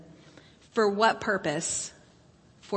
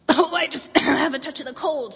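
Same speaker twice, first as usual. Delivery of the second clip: wolf faking a squeak, higher; squeaky wolf voice; 5 semitones higher, 3 dB louder than usual. Acted. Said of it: "squeaked the wolf".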